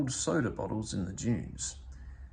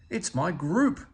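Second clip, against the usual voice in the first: higher, louder happy exclamation; exclaimed; 6 semitones higher, 4 dB louder than usual. Acted. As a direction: burst out with delight at finding them. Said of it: "Oscar exclaimed".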